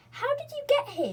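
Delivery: high, loud astonished voice; can't believe they're here; amazed question. A narrator's reading. Joe asks his sisters, high and amazed. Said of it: "he asked".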